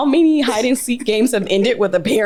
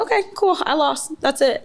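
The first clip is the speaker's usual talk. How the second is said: high, breezy fake-casual shrug; airy brush-off after losing a game; forced nonchalance; 7 semitones higher than usual. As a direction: pretend losing doesn't bother you. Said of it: "I just go".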